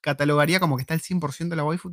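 usual speaking voice

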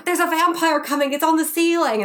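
loud, high urgent prompting; urgent director calls. Retold or imitated. Casting director feeding a vampire scene to actress, urgent and loud. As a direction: call out the danger, mock urgent.

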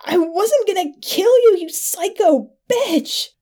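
high wail slowing to a moan; anguished moan. A narrator's reading. Stabbed man to his attacker, high pained moan. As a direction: moan it high, dragging out the insult. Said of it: "he moaned".

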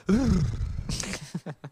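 high falling horse whimper breaking into laughter; comic animal whimper; mock horse whimper. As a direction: whimper like a horse, then crack up. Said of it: "Austin let out a horse whimper".

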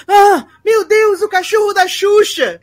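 high, loud and slow cry of alarm; shocked shout. Retold or imitated. The boy spots [name]'s dog: loud, high shocked shout.

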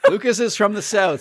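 laughing. Real, not acted. Excuse after joke, laughing.

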